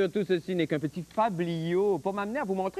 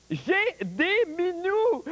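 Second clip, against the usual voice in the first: cutesy gushing; 9 semitones higher, much slower than usual. Acted. Shows off kitten cuteness.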